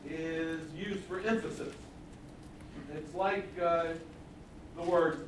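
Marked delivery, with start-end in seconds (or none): none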